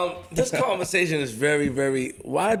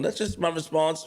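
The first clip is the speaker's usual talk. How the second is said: ordinary talk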